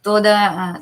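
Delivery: normal talking voice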